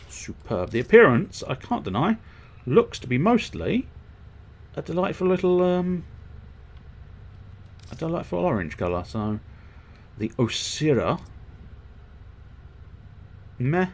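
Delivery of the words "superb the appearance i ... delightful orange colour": mock appraisal. Real, not acted. Gamer mock-admiring the weapon colour in a higher voice. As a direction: play the fussy critic.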